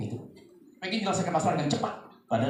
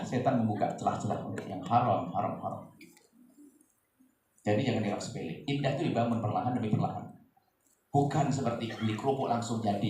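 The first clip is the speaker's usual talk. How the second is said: ordinary talk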